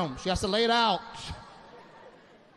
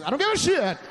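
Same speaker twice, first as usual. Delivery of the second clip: exploding outburst; 8 semitones higher, 5 dB louder than usual. Retold or imitated. His inner reply.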